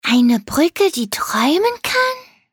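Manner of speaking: stark steigende, staunende Frage; ungläubig staunend nachfragen; erstaunt. A narrator's reading. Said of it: "fragte Leonardo erstaunt". (erstaunt fragend)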